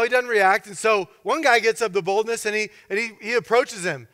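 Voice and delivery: usual speaking voice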